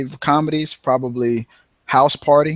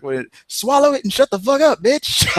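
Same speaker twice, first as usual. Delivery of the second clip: high, cranky comeback; Red Fox snapping back; 12 semitones higher than usual. Retold or imitated. Red Fox firing back at the old lady, high and cranky. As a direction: throw the insult back like a grumpy old man. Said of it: "Red Fox was like".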